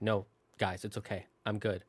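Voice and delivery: quiet reassurance. Retold or imitated. Acting out reassuring toy at storytime.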